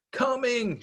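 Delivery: put-on high little girl shout; shouting back. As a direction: call back brightly. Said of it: "she shouted".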